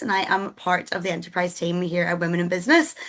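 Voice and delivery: ordinary delivery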